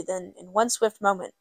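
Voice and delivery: ordinary delivery, nothing marked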